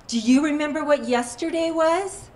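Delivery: loud, high, pointed question; retold confrontation. Woman reminds driver of the accident's anniversary, loud and accusing. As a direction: press the question hard, climbing to a rising end.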